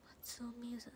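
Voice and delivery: 息だけで名前をささやく; 内緒話のように声を出さずに; ささやき. Real, not acted. 名前をそっと読む. (名前を息だけでこっそり)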